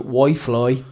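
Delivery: "Wi-Fi" said as "Why-Fly"; "Wi-Fi" with a second syllable like "fly"; mispronounced Wi-Fi. Retold or imitated. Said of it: "she's been thinking Wi-Fi is Wi-Fi".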